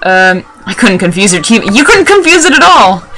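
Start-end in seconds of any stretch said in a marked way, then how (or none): 1.7-3.0 high, raised-voice taunt; taunting retort. Real, not acted. Gamer taunting the confusion room trainer, mocking outburst. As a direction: throw it back high and mocking.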